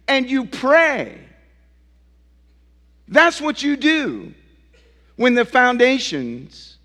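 high, slow, emphatic preacher cadence; ringing sermon climax in measured phrases; preaching at full force. Real, not acted. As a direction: drive each charge home, drawing out the key words. Builds a preached list of charges.

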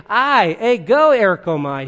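"I, ego erkomai" with I and ego punched loud and high; emphatic pronoun. Shown as a demonstration. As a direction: hit "ego" hard before "erkomai". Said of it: "So he can be emphatic".

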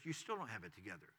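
low, soft and quick aside; retold whisper. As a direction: drop to a hushed, voiced imitation of a whisper. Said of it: "and then he whispers".

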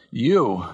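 "You" set apart and leaned on; stress on YOU. Retold or imitated. God the Father addressing his Son, stress on "You". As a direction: land on "You" alone, then pause. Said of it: "God the Father speaking to the Son".

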